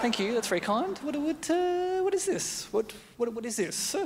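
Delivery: high puzzled partner voice; rising confusion, high and wary; girlfriend voice. Acted. Partner voiced opening gift.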